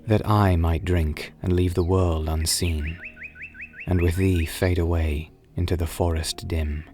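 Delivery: ordinary delivery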